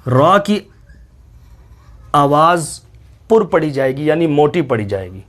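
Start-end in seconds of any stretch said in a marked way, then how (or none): none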